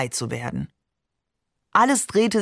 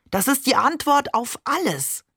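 higher-pitched, fervent conviction; earnest enthusiasm; 6 semitones higher than usual. A narrator's reading. Father reveals his new faith to his daughter, enthusiastic. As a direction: proclaim it with eager certainty.

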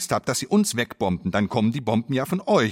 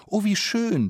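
high, sweet mock admiration; gushing praise; 10 semitones higher, slower than usual. City visitors admiring the glass building, gushing and patronising. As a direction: coo it like a condescending visitor. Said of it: "sagt ihr".

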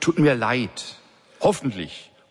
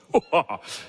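booming belly laugh; 4 semitones higher than usual. A narrator's reading. Boss bursting into belly laughter on the phone. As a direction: let a big laugh roll out. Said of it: "dröhnende, tiefe, bauchige Lachen".

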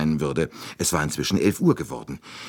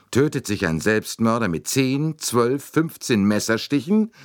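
incisive, higher-pitched rhetorical question; cutting challenge, pitched up; 6 semitones higher than usual. A narrator's reading. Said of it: "fragte er schneidend".

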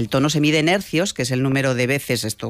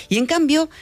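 put-on voice, far higher than usual; high-pitched voice; 13 semitones higher than usual. Shown as a demonstration. Host illustrating the voice pictured as young and shy, very high-pitched. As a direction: pitch it way up, like someone small and young. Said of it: "si escuchaban una voz más aguda".

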